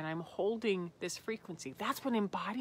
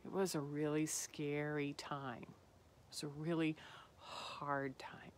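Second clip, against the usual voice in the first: sad, fading to a whisper; 3 semitones lower, 7 dB quieter than usual. Real, not acted. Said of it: "I think you can hear it in my voice".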